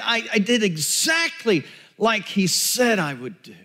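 high, agonized confession, acted out; anguished self-reproach. Peter regrets denying Jesus three times, anguished self-reproach. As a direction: berate yourself in a high, pained voice.

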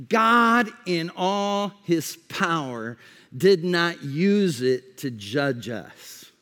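usual speaking voice

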